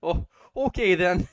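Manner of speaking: high, loud mock Canadian accent; terrible Canadian accent. Canadian patient accepts the death fairy's offer, bad Canadian accent. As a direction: meekly agree in a hammed-up Canadian accent. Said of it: "The Canadian is like".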